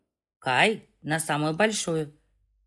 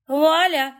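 high, loud «Вуаля!» like a magic trick; tipsy triumphant cry; 8 semitones higher, 5 dB louder than usual. A narrator's reading. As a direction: announce it with a flourish. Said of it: "заявила она, хлопнув в ладоши". (пьяный торжествующий возглас)

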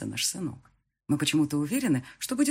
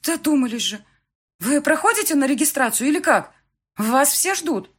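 high, loud, impatient reproof; scolding clerk; 10 semitones higher, 7 dB louder than usual. A narrator's reading. Registry clerk hurrying the wedding party inside, scolding. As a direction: chide them and hurry them along.

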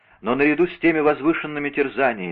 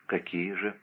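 sorrowful; 3 semitones lower, 10 dB quieter than usual. A narrator's reading. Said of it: "спросила горестно".

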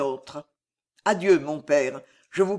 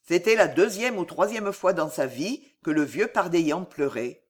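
usual speaking voice

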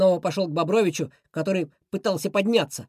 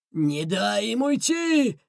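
slow, drawn-out rough roar; snarled bandit command; growling; 3 semitones higher, much slower than usual. A narrator's reading. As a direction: growl the order, stretching each word. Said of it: "рычал Бобр-Боб".

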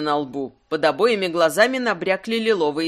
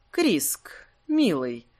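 purring femme fatale; 2 semitones lower, much slower than usual. A narrator's reading. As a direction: purr his name sweetly. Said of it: "промурлыкала Каста".

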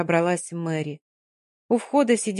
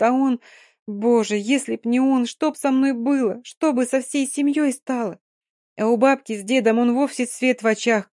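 put-on grieving girl, building to despair; 4 semitones higher, 3 dB louder than usual. Dina, distraught and pleading.

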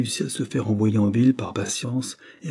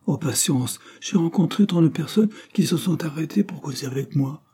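put-on evasive girl; 8 semitones higher than usual. Anna excuses herself, high voice.

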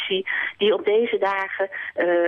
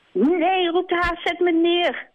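much higher, scared hare crying out; panicking hare; 12 semitones higher, slower than usual. Acted. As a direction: shout to be put down. Haas panicking.

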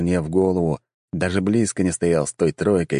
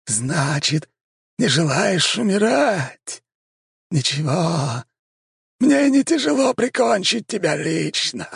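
sepulchral voice; 12 semitones higher, slower than usual. A narrator's reading. Vampire speaks from everywhere.

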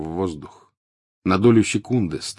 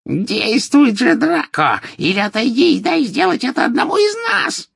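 very high, louder squeal; shrieking witch; 6 dB louder than usual. A narrator's reading. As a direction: screech at Draco impatiently. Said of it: "визгливо вскрикнула колдунья". (визгливо, очень высоко и громко)

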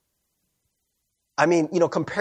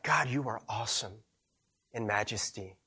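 hushed worship; 5 semitones lower, 10 dB quieter than usual. Retold or imitated. Recalling a worship moment.

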